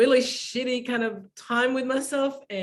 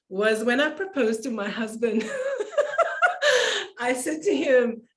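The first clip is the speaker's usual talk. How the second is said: laughing; 4 semitones higher than usual. Real, not acted. Laughing while telling proposal story.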